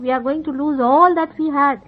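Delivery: normal talking voice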